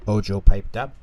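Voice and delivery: plain reading voice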